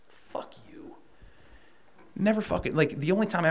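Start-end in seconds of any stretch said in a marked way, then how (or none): 0.1-1.0 voiceless hissed fuck you; bitter whisper. Real, not acted. A bitter aside at the people who lied to him, whispered. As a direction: spit it under your breath.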